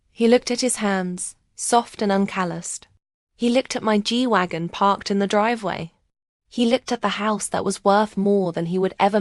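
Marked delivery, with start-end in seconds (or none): none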